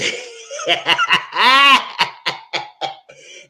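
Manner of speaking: shrieking laugh; loud high cackle. Real, not acted. Laughing at the contestants.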